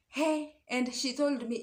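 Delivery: normal talking voice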